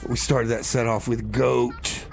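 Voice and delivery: ordinary delivery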